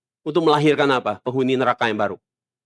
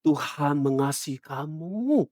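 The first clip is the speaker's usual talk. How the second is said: soft, slow syrupy reassurance; mock soothing pastor; 5 semitones lower, 9 dB quieter, slower than usual. False pastor to the congregation, mock soft and slow. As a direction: coo it like a pastor glossing over sin. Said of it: "mereka bilang".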